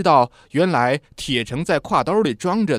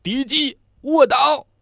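sudden high shout of alarm; warning yell; 12 semitones higher, 3 dB louder, slower than usual. A narrator's reading. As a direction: yell the warning to drop flat. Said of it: "铁成朝二山大喊道".

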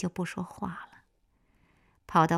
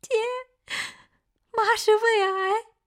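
high, slow, sobbing; crying on the phone; 7 dB louder, slower than usual. A narrator's reading. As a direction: sob out the terrible news. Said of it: "夏季哭着给夏红打电话".

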